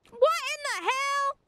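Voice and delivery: high, slow, drawn-out disbelief; exasperated. Real, not acted. Player watching the dragon undo the damage, slow high exasperation. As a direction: stretch out your disbelief as the fight turns. Boss heals again.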